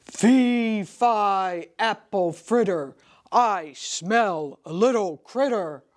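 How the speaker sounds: booming giant chant. Acted. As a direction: boom it like a giant. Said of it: "a giant voice filled the hall".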